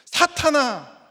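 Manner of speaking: high, loud acted call; sharp rise in pitch and volume; shock rebuke. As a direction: jolt them awake with one cry. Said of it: "충격을 확 주시고".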